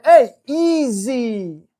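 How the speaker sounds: loud, very slow, drawn-out correction; slow, loud, stern hey easy; firm warning. Shown as a demonstration. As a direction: stretch it out so the dog gets it. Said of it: "And I kinda shove it in his face".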